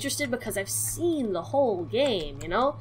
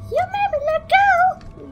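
very high indignant yell; outraged shriek; 5 dB louder than usual. Real, not acted. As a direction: shriek the blame at her. Blaming companion after falling.

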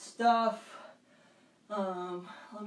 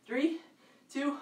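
usual speaking voice

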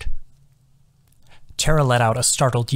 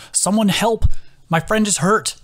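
loud desperate call, pitched high; calling for help; 9 semitones higher, 3 dB louder than usual. A narrator's reading. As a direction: shout across the woods for help. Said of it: "she heard a call".

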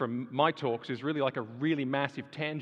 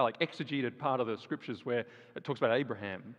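ordinary talk

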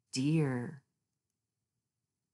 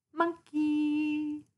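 much higher, cheeky lilt; playful high voice; 10 semitones higher than usual. Acted. Narrator names the monkey to children in a cheeky high voice. Playful animal name reading.

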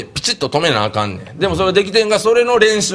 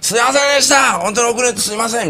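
high, desperate shouted apology; yelling sorry as you skid in late; frantic apology; 8 semitones higher than usual. Retold or imitated. Late junior to the strict master, frantic shouted apology.

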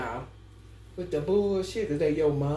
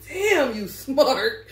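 slow, drawn-out admiring praise; 7 semitones higher, 5 dB louder, much slower than usual. Retold or imitated. Her friend praising her, slow drawn-out admiration. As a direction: stretch it out, impressed. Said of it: "She just kept saying".

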